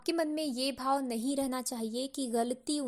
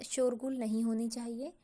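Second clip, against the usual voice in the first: ordinary talk, nothing marked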